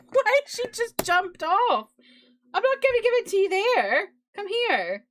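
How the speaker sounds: talking to the cat. Real, not acted. Streamer talks to her jumping cat.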